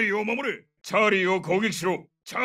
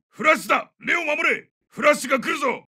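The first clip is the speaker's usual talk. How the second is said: high shouted alert; urgent warning; 7 semitones higher than usual. Acted. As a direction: warn the squad before it goes off. Warning squad of flashbang. (切迫した警告の叫び)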